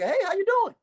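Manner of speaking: high, tight nervous repeat. Retold or imitated. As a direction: force a nervous hello again. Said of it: "I'm nervous in the motherfucker".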